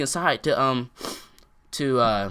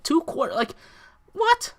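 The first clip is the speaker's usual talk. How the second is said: incredulous; 12 semitones higher than usual. Retold or imitated. Reacting to a two-quarter playground ban, incredulous. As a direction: squeak the question in mock shock.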